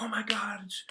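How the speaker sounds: high-pitched, overjoyed mock outburst; hammed-up thrilled disbelief; thrilled mother. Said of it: "She's thrilled".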